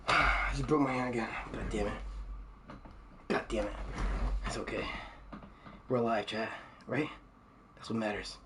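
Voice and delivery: low, quiet and winded; out of breath. Real, not acted. Streamer winded after hurting their hand, out of breath. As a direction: speak softly, spent after an outburst. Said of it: "I'm out of breath".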